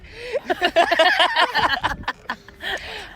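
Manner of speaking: high, loud laughter; long laugh. Real, not acted.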